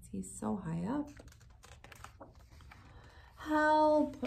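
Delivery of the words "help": higher, louder shout for help. A narrator's reading. Elmer stuck on the cliff, higher loud shout. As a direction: yell for help. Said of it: "Elmer called again".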